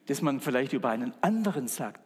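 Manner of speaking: normal talking voice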